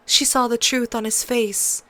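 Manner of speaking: usual speaking voice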